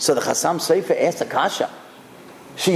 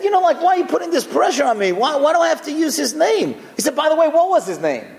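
pressured complaint; 8 semitones higher, faster than usual. Retold or imitated. Son protests the naming pressure.

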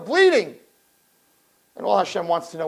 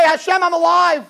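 calling out to God; 8 semitones higher, 4 dB louder than usual. Acted.

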